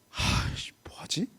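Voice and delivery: sighing, barely voiced grumble; very soft, exhaled self-talk; muttered dismay. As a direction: mutter to yourself, at a loss. Runaway son muses to himself, stuck.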